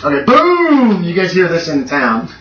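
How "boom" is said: long, high-pitched "boom"; imitated explosion boom. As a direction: draw out a big explosion boom. Game master imitates the explosion.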